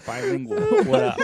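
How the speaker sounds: high, loud gangster swagger; hammed-up tough guy. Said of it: "You turned all, like, gangster".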